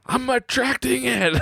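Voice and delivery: put-on high, slow awed kid voice; ecstatic son. Son freaking out over money, high slow child voice. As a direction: marvel like a child who found magic.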